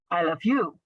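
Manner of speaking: "you" punched last, with a falling tail; stress on YOU. Shown as a demonstration. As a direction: hit the final word "you". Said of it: "with the stress on the last part".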